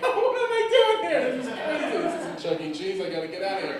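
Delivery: high giggly squeal, easing down; mock giddy, high laughing disbelief; acted open laughing. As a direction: laugh through it as if you can't believe it. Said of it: "I just started laughing, like, openly".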